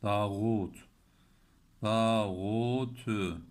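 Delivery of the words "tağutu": Tağutu with a thick t and a throaty ğ, slow; Tağutu drawn out, ğ rasped; heavy ta. Shown as a demonstration.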